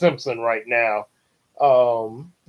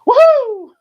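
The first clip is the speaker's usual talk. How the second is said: shrieked woohoo, much higher and louder; high cheer; 10 dB louder than usual. Retold or imitated. Kids cheering to escape church, loud whoop. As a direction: whoop with glee.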